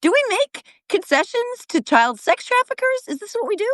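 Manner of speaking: high, disbelieving questions; outraged pitch leap on the questions; incredulous outrage. Real, not acted. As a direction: ask it sky-high in disbelief.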